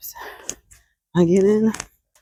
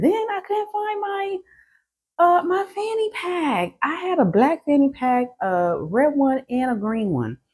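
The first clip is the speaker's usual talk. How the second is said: high-pitched; 10 semitones higher than usual. Real, not acted. High squeaky voice telling fanny pack woes.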